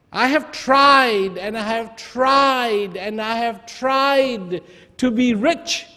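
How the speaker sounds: high, slow, pleading lament of a poor man; weeping man. Acted. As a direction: wail out your bad luck, high and dragging. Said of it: "the person who was weeping".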